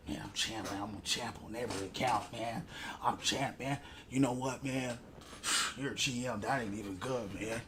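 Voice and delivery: low, soft droning mock brag; mock bragger. Mocking the teammate who brags about champ, low and soft. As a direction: imitate the bragger in a dull mumble.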